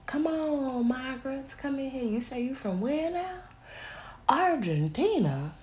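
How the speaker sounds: high, sing-song mock hospitality; mock welcoming host. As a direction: play the eager host inviting migrants in. Mocking welcoming people to migrants.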